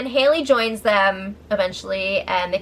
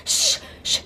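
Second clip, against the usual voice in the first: voiceless mock shushing; hushing sound, retold; 4 dB quieter than usual. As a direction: imitate a dismissive shush. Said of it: "Bailey freaking shushes her".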